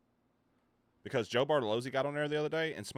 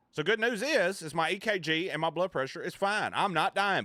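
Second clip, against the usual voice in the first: excited relief; 8 semitones higher, 4 dB louder than usual. Real, not acted. Relieved health news.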